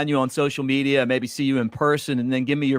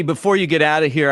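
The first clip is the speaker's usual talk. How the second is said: normal talking voice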